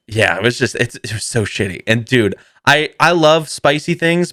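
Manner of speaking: ordinary talk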